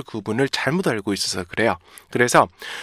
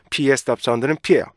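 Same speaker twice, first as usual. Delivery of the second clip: ordinary talk, nothing marked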